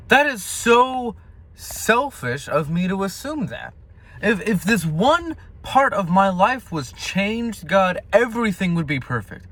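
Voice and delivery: high, impassioned, exasperated preaching; indignant self-rebuke. Real, not acted. Scolding himself for assuming God owes him, indignant.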